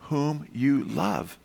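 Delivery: "Whom you love" very slow, soft and weighty; slow emphatic quote. Shown as a demonstration. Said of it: "And then he says".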